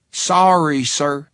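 put-on friendly Texas accent, laid on thick. A narrator's reading. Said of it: "she said in a Texas accent".

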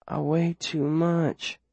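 slow soft slurred words; words melting together. Acted. Drugged girl protesting to the boy, slurring. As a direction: let the words melt together. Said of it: "her words melting together".

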